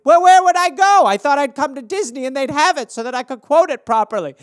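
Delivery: a hint of a high whiny fan; mock clueless guest.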